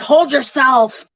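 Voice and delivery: slow, loud flat brush-off; mock curt wife. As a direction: shut him down slowly and firmly. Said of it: "she'll be all like".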